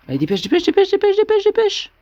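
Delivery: desperate high-pitched hurry-up; frantic urging. Real, not acted. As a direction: scream at them to hurry up.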